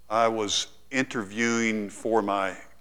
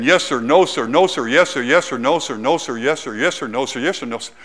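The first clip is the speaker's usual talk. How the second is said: soldier's rapid replies; 2 semitones higher, 9 dB louder than usual. Retold or imitated. Soldier answering a yelling colonel.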